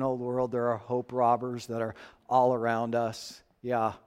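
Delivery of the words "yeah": ordinary delivery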